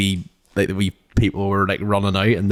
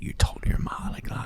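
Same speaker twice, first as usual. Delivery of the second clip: a whispered stern father; whispered scolding; 9 dB quieter than usual. Retold or imitated. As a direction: whisper the dad's warning. Dad's scolding retold in whisper.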